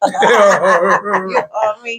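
amused, laughing humblebrag; laughing boast. Real, not acted. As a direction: brag playfully while laughing. Joking boast amid shared laughter.

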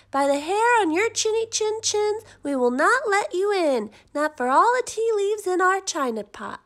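high, loud, squeaky defiance; defiant little wolves. A narrator's reading. Wolves defy the big bad pig, high and loud. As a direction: puff up like small brave wolves.

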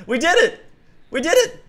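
high, loud happy cheer; victory cheer. Real, not acted. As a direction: celebrate the win. Victory celebration.

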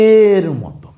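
ordinary delivery

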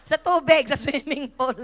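laughing. Real, not acted. Laughing at own story.

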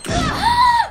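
chillido muy agudo y fuerte; grito corto y agudo, de golpe; grito de susto. Real, not acted. Le lanzan una granada. (alarido agudo de sorpresa)

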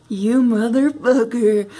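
glare at the enemy in the mirror; mock menace. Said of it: "And I'm like".

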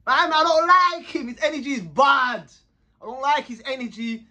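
heated retold rant; retold complaint. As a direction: tell D straight out you dislike him. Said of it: "I'm saying".

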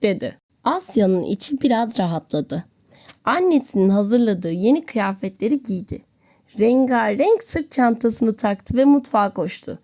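ordinary delivery

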